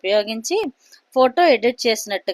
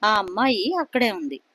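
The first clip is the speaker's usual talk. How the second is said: usual speaking voice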